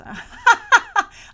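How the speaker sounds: real laugh bursting out high and loud; high loud burst of laughter. Real, not acted. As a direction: cackle at his own gym class joke. Laughing at own gym joke.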